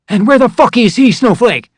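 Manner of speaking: high, loud angry demand; livid drill sergeant. Retold or imitated. Drill sergeant demanding where the battle buddy is, furious. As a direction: snarl the question at the recruit. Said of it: "he was livid".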